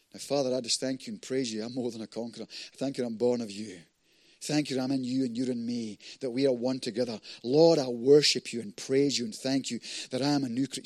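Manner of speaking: soft, low thanksgiving prayer; quiet prayer. Shown as a demonstration. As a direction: pray intimately, voice dropped low. Prays aloud to God.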